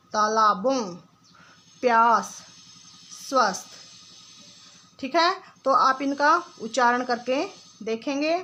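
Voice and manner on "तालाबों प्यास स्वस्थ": each word said alone, clearly and deliberately, with long pauses; careful word drill. Shown as a demonstration. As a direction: model every word for the class to repeat. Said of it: "आप मेरे साथ बोलने की कोशिश करेंगे".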